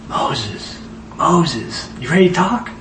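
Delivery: first name whispered, then a soft calling voice. Acted. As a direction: call him gently, barely above a whisper. Yahweh calls Moses gently.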